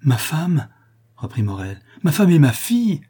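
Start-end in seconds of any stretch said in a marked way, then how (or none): 0.0-0.7 higher, surprised question; mildly astonished exclamation; astonished recognition. A narrator's reading. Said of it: "avec un nouvel étonnement". 2.0-3.0 high, loud joyful exclamation; raised voice full of astonishment; amazed recognition. A narrator's reading. Dazed father recognises his family.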